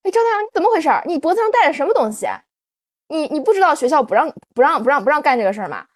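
high, loud, quick telling-off; imitating a stern young teacher catching a student; teacher scolding. Young homeroom teacher scolding the girl at the door, sharp and quick. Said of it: "堵着我说".

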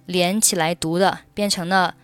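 ordinary talk, nothing marked

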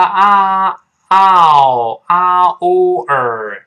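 loud, drawn-out 啊, 哦, 儿 in steps; sounding out vowels. Shown as a demonstration. Teacher breaking down flower, loud stretched vowel sounds. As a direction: stretch 啊 into 哦, then curl into 儿. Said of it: "这么一个声音".

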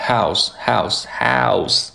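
"house" slowly, with a wide-open OU vowel; drawn-out demo of the vowel in "house"; open-mouthed vowel. Shown as a demonstration. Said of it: "把嘴张开".

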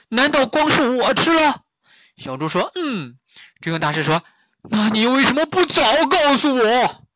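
high, loud frantic questions; alarmed. Acted. Master frantically questioning the pig, high and loud. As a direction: panic rising, blaming.

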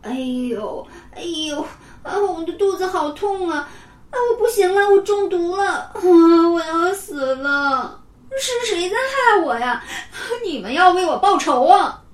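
put-on a boy with a stomachache wails dramatically over his desk; hammed-up wailing in pain. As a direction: overact a bellyache like dying. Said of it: "趴在课桌上乱叫唤".

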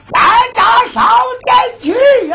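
high, shouted rallying call; loud call. Acted. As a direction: call everyone to the hall at full voice. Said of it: "一声说道".

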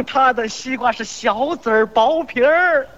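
slow hawker's chant with long endings; mock vendor's cry. Seller praising his rival's melon, drawn-out vendor's cry. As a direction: drag it out for the market crowd. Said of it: "互相夸".

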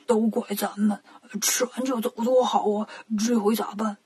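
low, soft aside in a lightly put-on voice; hushed worry. 八戒小声跟悟空说, hushed. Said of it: "小声跟悟空说".

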